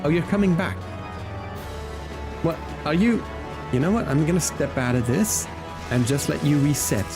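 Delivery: normal talking voice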